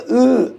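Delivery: a short, loud, high mocking "uh"; teasing noise. Shown as a demonstration. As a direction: tease someone with a bratty "uh". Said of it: "हम तो ऐसे चिढ़ाते हैं". (चिढ़ाने वाली ऊँची, तेज़ "उ" की आवाज़)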